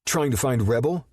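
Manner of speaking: high, loud, spitting it back; teen spitting back at the older man; spat. A narrator's reading. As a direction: snap back defiantly at Rip. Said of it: "Boston spat".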